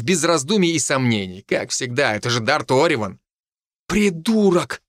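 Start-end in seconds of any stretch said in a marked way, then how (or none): 3.9-4.8 high-pitched, squeezed-out insult; strained, exasperated jab. A narrator's reading. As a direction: force the insult out in a higher voice. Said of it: "выдавил Хинниган".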